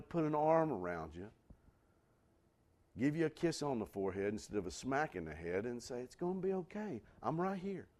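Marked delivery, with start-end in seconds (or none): 6.0-7.9 soft, lifted comforting voice; tender reassurance. Acted. Comforting someone who needs to be heard, tenderly. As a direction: reassure gently and quietly.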